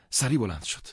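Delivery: ordinary delivery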